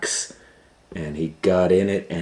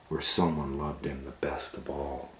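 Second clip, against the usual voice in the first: ordinary delivery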